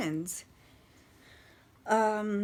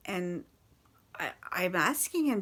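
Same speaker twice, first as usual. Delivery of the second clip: ordinary talk, nothing marked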